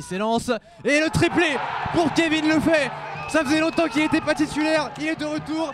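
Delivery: shouted goal call; excited sports commentary. Real, not acted. Hat-trick goal call.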